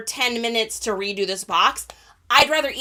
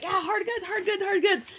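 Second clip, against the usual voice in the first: mock cheering; 10 semitones higher than usual. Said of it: "everyone's like".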